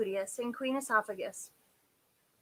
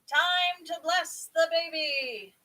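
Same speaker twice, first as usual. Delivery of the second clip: louder, slow, higher announcement; calling out; 7 semitones higher, 3 dB louder, slower than usual. A narrator's reading. King calling time to bless the baby, calling out. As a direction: call it out slowly, higher and louder.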